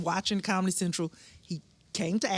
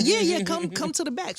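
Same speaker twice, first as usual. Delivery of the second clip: much higher welcoming invite; wife inviting her backstage, eager; eager invitation; 10 semitones higher, 3 dB louder than usual. Retold or imitated. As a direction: beckon warmly. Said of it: "she was like".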